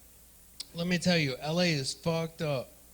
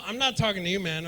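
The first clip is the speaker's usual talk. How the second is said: ordinary delivery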